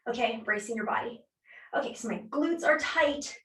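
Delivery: ordinary talk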